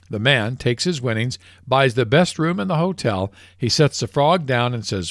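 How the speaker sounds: normal talking voice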